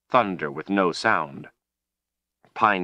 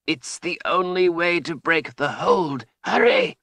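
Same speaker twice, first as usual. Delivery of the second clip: put-on high, strained old wizard, climbing to a cry; desperate urging; 11 semitones higher than usual. A narrator's reading. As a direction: urge her frantically, pitched high. Trapped wizard urging her on.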